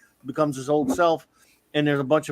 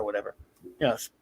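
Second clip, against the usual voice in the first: ordinary talk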